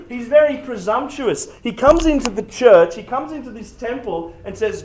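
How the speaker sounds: ordinary talk, nothing marked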